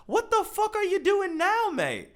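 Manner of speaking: high, loud what-the-hell reaction; shocked and loud; incredulous outburst. Real, not acted. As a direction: throw it at the screen in disbelief. Reacting in disbelief to the post.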